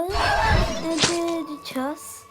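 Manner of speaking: slow, loud, drawn-out taunt; mock threat. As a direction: drag it out like a villain. Taunting classmates.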